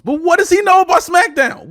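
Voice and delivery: outraged yell at the absurdity; indignant shout. Real, not acted. Host rants about a rapper on SmackDown, shouting. Ranting about celebrity guest.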